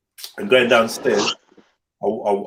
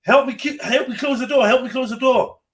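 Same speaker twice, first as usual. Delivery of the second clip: high, urgent retelling of a cry for help; retold plea for help; 11 semitones higher than usual. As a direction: replay the panicked call for help at a high pitch. Pleading call retold from dream.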